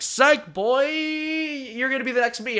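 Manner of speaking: high, drawn-out villain taunt; mock gotcha. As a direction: crow it slowly like a cartoon villain. Villain springs a gotcha, retold.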